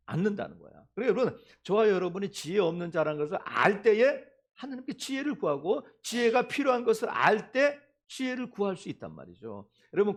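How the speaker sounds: ordinary delivery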